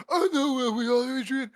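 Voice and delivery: high, whiny mock Rocky; hammed-up dopey lament. Rocky moaning to Adrian, high whiny mock lament. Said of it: "So he's like".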